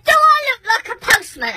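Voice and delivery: high rhetorical question; indignant challenge. Retold or imitated. Angry rhetorical question to neighbour.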